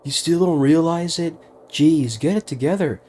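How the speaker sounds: lightly voiced smug taunt; mocking Veggie voice. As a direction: sneer at the group's cluelessness. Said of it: "That sounds like Veggie".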